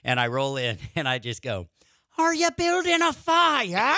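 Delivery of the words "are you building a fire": high, slow, bad leprechaun voice; drunk pirate leprechaun. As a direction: squeal it out slowly like a tipsy pirate leprechaun. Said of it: "just a bad leprechaun or something, like a drunk pirate leprechaun".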